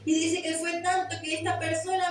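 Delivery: ordinary talk, nothing marked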